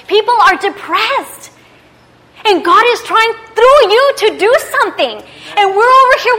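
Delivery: high, loud, building exhortation; fired-up preaching. Real, not acted. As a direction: preach it with rising force. Preaches at full force.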